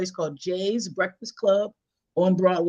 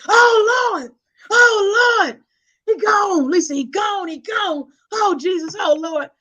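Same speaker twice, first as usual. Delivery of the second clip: high, loud, drawn-out lamenting, imitated; grandmother wailing; 11 semitones higher, 7 dB louder, slower than usual. Grandmother phoning with bad news, hammed-up wailing. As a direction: imitate grandma crying out in grief. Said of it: "but do the voices".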